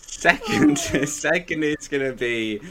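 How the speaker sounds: high laughing voice. Real, not acted. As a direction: giggle through it. Laughing at own pick.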